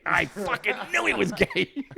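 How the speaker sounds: high, drawn-out triumphant repeat; emphatic vindication. Retold or imitated. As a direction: crow it slowly, breaking into laughter. Escalated repeat of the same reaction.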